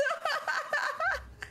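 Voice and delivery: high far-off evil cackle; wicked monster laugh. Pretend. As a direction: cackle over the prey. Monster laughs after kill.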